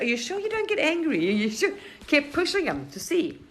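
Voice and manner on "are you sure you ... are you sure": much higher, louder needling question; goading taunt. Retold or imitated. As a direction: poke and prod him until he snaps. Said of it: "kept on saying".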